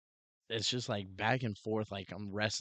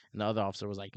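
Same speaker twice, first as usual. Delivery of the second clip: usual speaking voice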